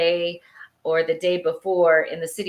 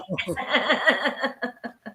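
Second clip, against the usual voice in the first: laughing; 4 semitones higher, 3 dB quieter than usual. Real, not acted. Laughing after witch voice.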